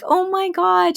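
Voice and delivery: high, slow, astonished gasp of words; stunned oh my god. Retold or imitated. Mother hearing her daughter's blunt advice, high and stunned. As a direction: act floored by what the child just said. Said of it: "And I was just like".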